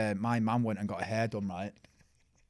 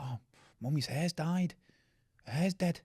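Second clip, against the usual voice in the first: higher, lightly voiced puzzled kid; alarmed child; 6 semitones higher, slower than usual. Kid telling family mummy's hair is dead, mock alarm. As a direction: imitate a small child taking it literally. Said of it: "I'm only a kid".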